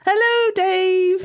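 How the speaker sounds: high, slow sing-song hello to the puppet; cheery puppet greeting. Acted. Presenter greeting puppet Dave on the call, high and sing-song. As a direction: greet the puppet brightly, drawn out.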